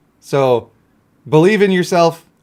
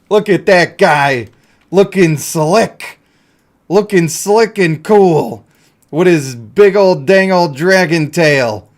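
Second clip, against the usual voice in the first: louder, slow, strutting admiration; swaggering hype; 2 semitones higher, 6 dB louder, slower than usual. Pretend. Streamer hyping up his dragon character, loud and slow swagger. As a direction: talk him up like a cool guy.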